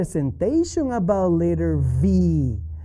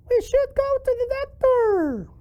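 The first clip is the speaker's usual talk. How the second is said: very high-pitched puppet voice; piping cartoon voice; squeaky puppet. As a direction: answer as a squeaky little character. Puppet Charlie answers the teacher.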